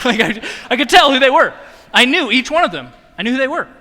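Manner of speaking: can't keep a straight face recalling the prank; laughing while telling. Real, not acted. Telling the church about the prank, laughing.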